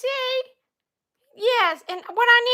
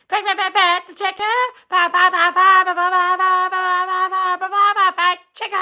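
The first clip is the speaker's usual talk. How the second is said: rapid-fire "fact, fact, fact" on one note; babbled monotone run of repeats; stuttering repetition; 3 semitones lower than usual. Pretend. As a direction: stutter "fact" like a stuck record. Frustrated stuttered repetition of phrase.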